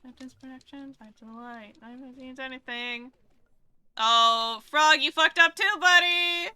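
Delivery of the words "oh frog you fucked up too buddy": big loud yell, pitched up; loud teasing shout at the screen; yell at teammate. Real, not acted. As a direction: holler at your buddy who blew it. Yelling at teammate in game.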